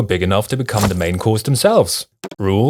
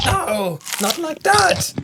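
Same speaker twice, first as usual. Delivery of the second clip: surprised; 3 dB quieter, slower than usual. Real, not acted. Reacting to a piglet.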